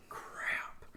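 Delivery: whispered crap under breath. Retold or imitated. Son regretting the missed ring, whispering. Retold disappointment missing ring.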